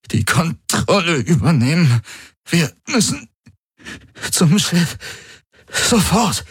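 high, strained, broken into gasped fragments; fighting for every word. A narrator's reading. Struggling Garland warns of the execution squad, gasping. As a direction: push each phrase out through the pain. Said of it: "als würde er um jedes Wort kämpfen".

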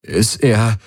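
faked break in the voice. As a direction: let the voice crack on purpose. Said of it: "ließ seine Stimme brechen". (brechende Stimme)

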